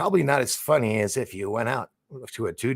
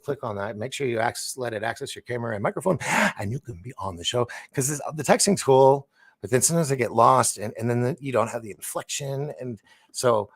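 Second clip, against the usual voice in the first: usual speaking voice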